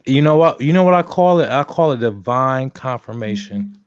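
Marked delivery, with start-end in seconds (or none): 0.1-1.5 excited build-up. Real, not acted.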